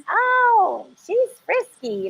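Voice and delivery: lightly hammed-up admirer's remark; mock admiring guy. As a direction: play a guy ogling the blonde. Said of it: "they like".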